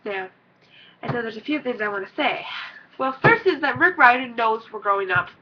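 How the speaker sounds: ordinary delivery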